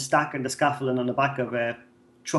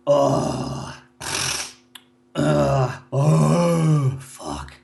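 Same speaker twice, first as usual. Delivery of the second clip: mock loud steam-room groan; much slower than usual. Loud man settling into the steam room, mock groan. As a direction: imitate a loud man easing into the heat. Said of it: "they come in and they go".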